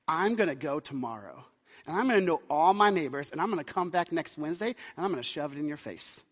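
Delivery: mock-seething, low and quiet; retold threat, kept low and soft; restrained fuming. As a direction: quietly vow to show them up. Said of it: "like, fuming".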